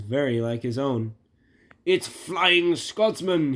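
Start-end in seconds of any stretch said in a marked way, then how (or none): 1.9-3.5 high, loud, drawn-out amazement; gasping. Acted. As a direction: stretch it out in awed surprise. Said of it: "he gasped".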